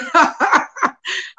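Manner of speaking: short chuckle; amused laugh at your own joke; breaking into a little laugh. Real, not acted. Amused at her own degree joke.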